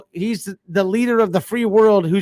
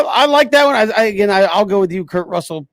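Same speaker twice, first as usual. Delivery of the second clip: ordinary talk, nothing marked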